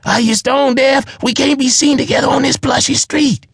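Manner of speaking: very high, loud, frantic pleading; choked dwarf shrieking at the giant; panicked screaming. A narrator's reading. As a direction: scream it out in terror of getting caught.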